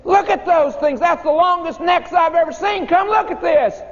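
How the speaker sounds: high, thrilled shout at the window; excited kids shouting. Retold or imitated. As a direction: yell it like a wide-eyed schoolkid. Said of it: "and we said".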